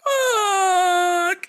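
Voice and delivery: high, loud yell from off-frame; distant imitated yell. Keanu vanishing through a fake window, off-screen yell. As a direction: yell it as if from out of the shot. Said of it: "you just hear".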